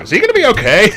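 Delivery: loud, high-pitched worried question; mock alarm. Asking drinking buddies about the drunk kid, mock alarm. Retold worry over passed-out drinker.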